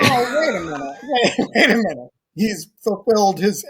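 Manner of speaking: loud, high, laughing wait a minute; laughing objection; laughing interruption. Real, not acted. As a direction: cut in, cracking up.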